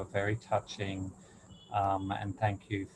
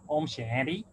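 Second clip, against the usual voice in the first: put-on Australian accent; 5 semitones higher than usual. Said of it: "and Nuwebai goes".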